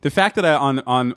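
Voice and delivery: ordinary talk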